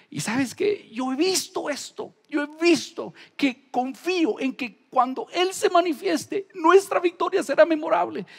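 fervent preacher climax; preaching. Acted. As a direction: rise into a triumphant proclamation.

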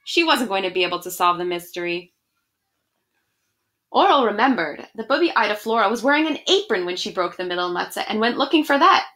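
ordinary delivery

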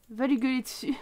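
ordinary talk

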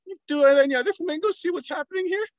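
high, loud squawking flamingo voice; distressed flamingo. Retold or imitated. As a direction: squawk like a panicked flamingo warning the flock. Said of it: "it started to go".

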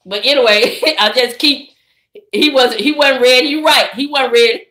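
normal talking voice

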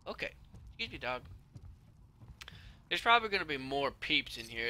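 ordinary talk, nothing marked

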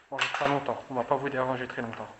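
loud, firm promise to spirits; loud reassurance. Real, not acted. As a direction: speak up so the spirits hear.

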